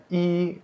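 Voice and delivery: i held high and level; first-tone i. Shown as a demonstration. Teacher isolating the counting form, high level i. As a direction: sustain i on one high pitch. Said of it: "nada satu".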